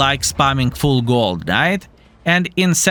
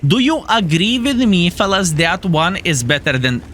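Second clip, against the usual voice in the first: usual speaking voice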